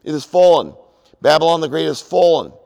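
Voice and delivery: a loud angel proclamation, "fallen" falling at the end; mighty voice. Acted. Angel crying out to the earth, mighty loud proclamation. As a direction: declare the angel's fall loudly. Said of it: "He called out in a mighty voice".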